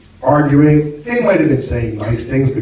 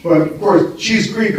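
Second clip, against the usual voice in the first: usual speaking voice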